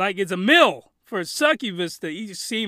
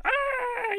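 high, soft mock porn moan; 5 dB quieter than usual. Mocking porn noises, high soft moan. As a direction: imitate an anime moan. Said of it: "the noises they make".

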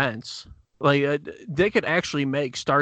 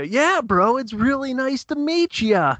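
higher, slower, overdone bro greeting; exaggerated bro voice; 11 semitones higher, 4 dB louder, slower than usual. Same greeting redone bigger.

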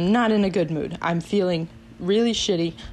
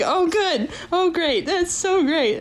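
much higher, mock tearful relief; imitated crying; 10 semitones higher, slower than usual. Student crying in professor's office, mock tearful relief. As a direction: act out blubbering thanks. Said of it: "And, uh, just crying".

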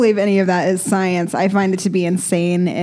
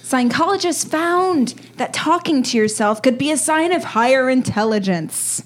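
ordinary talk

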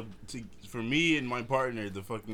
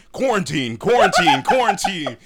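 loud, higher imitation of a hype man; hype man shout; 7 semitones higher, 10 dB louder than usual. Background dude hyping [name]'s livestream, imitated loud shout. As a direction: punch each word out like a hype man.

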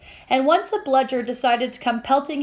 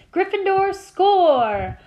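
higher, drawn-out goal call; excited commentary; 7 semitones higher, 3 dB louder, much slower than usual. A narrator's reading. Match commentator announces a score to the crowd, excited call.

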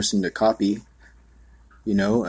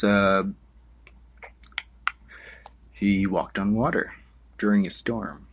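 ordinary talk, nothing marked

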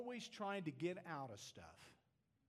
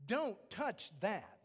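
slow, stressed Don't; slow firm command, weight on Don't; firm warning; 4 dB louder, slower than usual. Acted. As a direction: lay down the rule slowly. Voicing God's warning.

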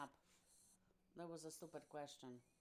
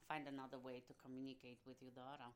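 ordinary talk

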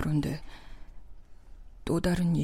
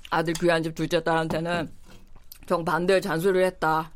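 ordinary talk, nothing marked

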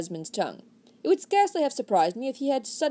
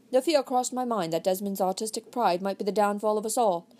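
plain reading voice

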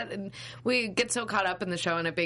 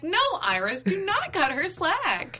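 much higher, slow, pleading; high-pitched protest; 12 semitones higher, slower than usual. Retold or imitated. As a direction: beg her not to let it go. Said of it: "I'm like".

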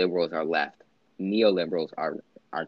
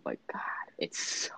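whispered; 7 semitones lower, 10 dB quieter than usual. Real, not acted. Awed aside about the debate.

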